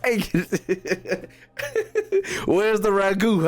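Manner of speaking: high-pitched rant broken by laughter; laughing rant. Real, not acted. Streamer ranting to chat about school languages while laughing.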